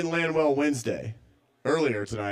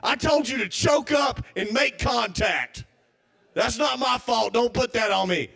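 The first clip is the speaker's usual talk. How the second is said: gruff scolding father, mildly raised; mock angry dad; 7 semitones higher than usual. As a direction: scold like an irritated old-school dad. Acted father-son argument.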